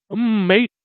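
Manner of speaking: long humming "Mm" gliding into a high "mate"; dragged M. Shown as a demonstration. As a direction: stretch the M before "mate", lifting at the end. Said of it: "You have to drag that M".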